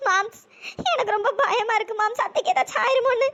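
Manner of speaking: put-on scared, teary young woman; tearful young woman. Preethi tells Krishna she is scared, tearful. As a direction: whimper it, frightened for her aunt.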